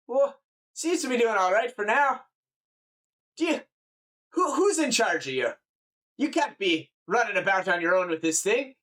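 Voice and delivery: high, fussing Scottish voice; Scottish farmer. Acted. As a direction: berate them as a Scottish countryman. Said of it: "like a Scottish person or whatever".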